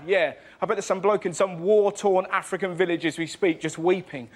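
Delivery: ordinary talk, nothing marked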